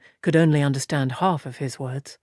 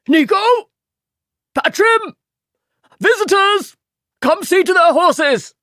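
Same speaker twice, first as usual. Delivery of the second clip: much higher, louder calling out; bellow; 13 semitones higher, 6 dB louder than usual. A narrator's reading. Innkeeper bellowing to the stable boys for help. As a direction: yell across to the stable. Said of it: "turned to bellow".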